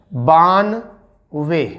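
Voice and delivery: each syllable slowly drawn out; slow dictation. Shown as a demonstration. Teacher dictating numbers to students, slow and drawn out. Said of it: "इसे कहते हैं".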